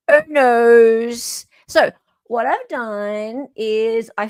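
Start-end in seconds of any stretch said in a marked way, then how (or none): 0.1-1.5 mock dismay. Mock dismay, louder repeat.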